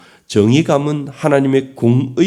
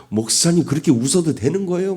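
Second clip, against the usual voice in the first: higher-pitched mock indignation; mock angry; 6 semitones higher, faster than usual. Churchgoer scolding the pastor for giggling, mock angry. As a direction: imitate a mildly outraged churchgoer. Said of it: "화를 내면서".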